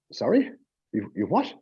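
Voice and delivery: high-pitched incredulous questions; baffled. Retold or imitated. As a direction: retell a stunned double take on the phone. Baffled reply to the ambulance call.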